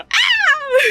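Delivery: high excited squeal; excited shriek. Real, not acted. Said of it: "I just got so excited".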